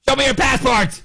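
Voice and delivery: high barked order from a guard; gruff customs guard. Retold or imitated. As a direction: bark it like a bossy customs officer.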